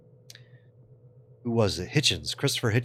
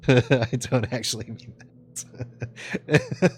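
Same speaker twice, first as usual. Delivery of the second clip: laughing; 5 semitones higher, much slower than usual. Real, not acted. Backpedalling on the French joke.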